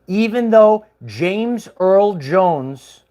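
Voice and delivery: drawn out slowly for effect; slow emphasis. Shown as a demonstration. Building up to a reveal for viewers, slowly. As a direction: stretch each name slowly.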